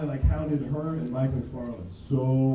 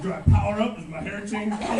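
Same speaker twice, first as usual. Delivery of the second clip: higher, louder, laughing eager questions; eager fan; 9 semitones higher, 8 dB louder than usual. Retold or imitated. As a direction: ask like a thrilled kid. Eager questions in retold story.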